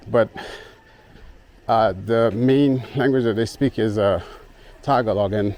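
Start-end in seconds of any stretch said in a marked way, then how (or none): none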